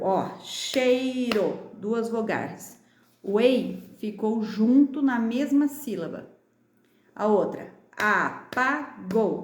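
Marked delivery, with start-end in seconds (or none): 0.4-1.7 "cheiro" very slow, as chei-ro; teacher sounding out chei-ro slowly; syllable by syllable. Shown as a demonstration. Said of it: "bate a palminha aí das sílabas". 7.9-9.4 "apagou" split a - pa - gou; very slow a, pa, gou, gaps between; syllable by syllable. Shown as a demonstration. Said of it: "bate a palminha aí das sílabas".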